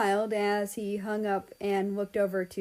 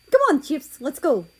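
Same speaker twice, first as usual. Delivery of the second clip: cheerful call; 6 semitones higher, 8 dB louder than usual. A narrator's reading. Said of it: "he said with a smile".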